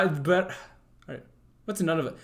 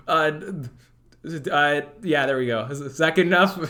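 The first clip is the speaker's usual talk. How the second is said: ordinary talk, nothing marked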